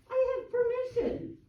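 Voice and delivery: very high-pitched insistence; retold heated protest. Gardener answering board member Ray, high heated protest.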